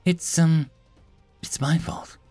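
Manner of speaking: high, halting, small voice; hesitant, miserable admission; ashamed confession. A narrator's reading. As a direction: confess haltingly, full of guilt. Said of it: "burying her head in her hands".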